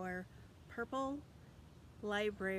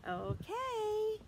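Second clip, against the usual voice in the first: put-on overbright kids party host; 11 semitones higher, 3 dB louder than usual. Teacher hyping kids for graduation.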